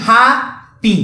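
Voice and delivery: ହାପି split as "haa ... pi"; two separate beats in ହାପି; syllable-by-syllable demo. Shown as a demonstration. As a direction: stretch the first syllable of ହାପି, pause, then "pi". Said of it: "ମଝିରେ ବନ୍ଦ ହେଲା ନାହିଁ".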